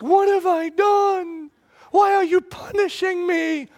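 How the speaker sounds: put-on high, loud, drawn-out whine of a wronged kid; whiny child protest. Child protesting to dad about being sent away, high whine. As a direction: play the kid who feels punished unfairly. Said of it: "I get that face like".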